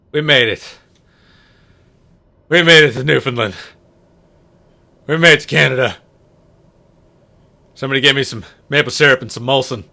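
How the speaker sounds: loud, joyful announcement of arrival; real triumphant cheer. Real, not acted. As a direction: call it out like you've just crossed the ocean. Celebrating landing in Canada.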